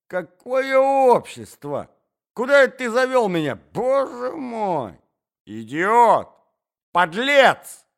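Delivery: высокий громкий крик в отчаянии; кричи, срываясь от гнева; гневный крик. Acted. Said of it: "кричала Марья Александровна". (Марья Александровна кричит на Мозглякова)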